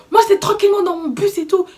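mock fuming at the police; retold outburst. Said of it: "péter un plomb".

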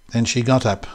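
plain reading voice

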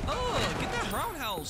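high, loud hype yell; excited cheer. Real, not acted. Streamer cheering a game kick, excited yell.

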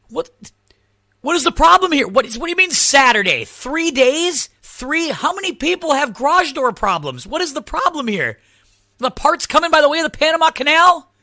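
replaying the call in mock outrage; exasperated disbelief. Retold outrage at repairman on phone.

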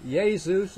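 "Jesus" as a slow YAY-zoos; Italian pronunciation. Shown as a demonstration. Preacher shows the name as they say it in Italy, Italian pronunciation. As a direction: open "Jesus" with a Y sound, drawing out both syllables. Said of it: "as they say in Italy".

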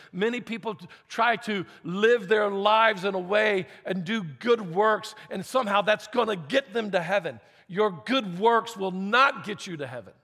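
fervent pulpit preaching; preaching with force. Acted. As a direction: drive the point home to the congregation. Pastor preaching the application.

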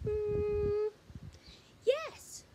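high, loud witch agreeing. Acted. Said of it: "said the witch".

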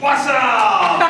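loud, high laughing call; laughing shout. Real, not acted. Speaker reacting to the roaring crowd, laughing shout.

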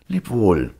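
a hint of cheerful little Hans; Hans's farewell. Little Hans answers the miller's goodbye, lightly voiced and loud.

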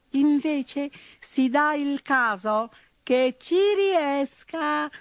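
high, slow, peevish squeak; petulant mouse. A narrator's reading. Mouse retorting to the bull, petulant squeak. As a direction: answer back like a cheeky mouse. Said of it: "replicò petulante".

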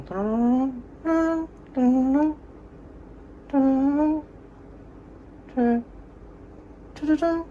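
high, sung-out "タララララン" like piano notes; imitated piano phrase. Vlogger imitates neighbour-like piano sound for viewers, high and sung. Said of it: "ちょっと高めのタララララン".

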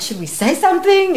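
high, agitated question; freaking out. Retold or imitated. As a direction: relive a flustered, urgent consultation. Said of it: "me and him are freaking out".

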